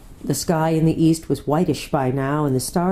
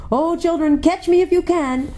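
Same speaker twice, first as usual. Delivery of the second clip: high, exuberant challenge; joyful call to a chase; playful lion; 12 semitones higher than usual. A narrator's reading. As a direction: dare them gleefully, high-pitched.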